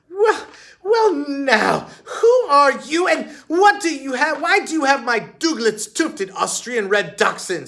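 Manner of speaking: very high, loud, flustered socialite; flustered stammer. A narrator's reading. Missus Nutbush flustered at Heidi taking her dog, very high and loud. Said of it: "stammered the flustered woman".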